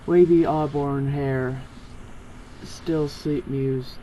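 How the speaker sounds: usual speaking voice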